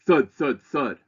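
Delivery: Thud, thud, thud read loud and high, each thud hit hard. Shown as a demonstration. Reading the poem to students, climaxing with loud thuds. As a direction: pound out the thuds, loudest of all. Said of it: "would get louder each stanza".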